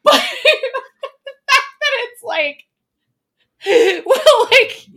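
high-pitched laughing words; giggling while trying to go on. Real, not acted. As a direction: laugh your way through it. Laughing through the story.

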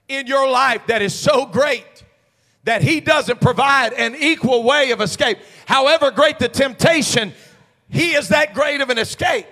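forceful preaching. Real, not acted. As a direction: press each phrase home to the congregation.

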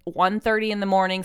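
ordinary delivery

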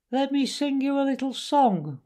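high-pitched speechmaker announcing a song; high squeaky weasel. A narrator's reading. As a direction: squeak it out to the banquet. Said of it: "began in a high, squeaky voice".

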